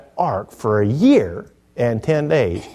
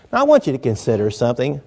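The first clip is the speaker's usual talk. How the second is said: ordinary talk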